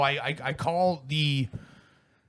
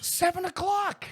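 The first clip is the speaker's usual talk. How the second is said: much higher, stunned emphasis; indignant. Real, not acted. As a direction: hit it like it's unbelievable. Speaker's indignant punchline.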